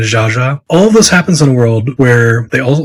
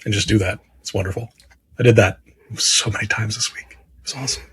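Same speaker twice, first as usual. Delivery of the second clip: whispered; 3 semitones lower, 10 dB quieter, faster than usual. Real, not acted. Confides about napping all week.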